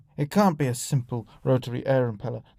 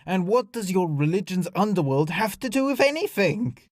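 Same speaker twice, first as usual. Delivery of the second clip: raised-pitch, exasperated question; snarled retort; 5 semitones higher than usual. Acted. Alien snaps back at diplomat's explanation, snarling. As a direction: climb toward an indignant finish. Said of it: "Igora snarled".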